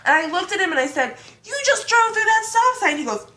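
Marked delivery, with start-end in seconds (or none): 1.5-3.0 high, loud retold protest; mock outraged accusation. Passenger telling driver he ran the sign, mock outrage. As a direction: accuse him at a raised pitch.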